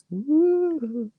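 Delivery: high excited woo; a sudden high whoop. Acted. Joking cheer.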